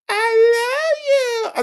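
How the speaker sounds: very high, slow crying falsetto; high mocking sob voice; mock whiny love. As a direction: wail it in a squeaky fake sob. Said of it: "This bitch gonna tell me".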